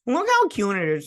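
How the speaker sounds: high-pitched gushing coo; squeal-coo it over a cute pet; playful cooing. Pretend.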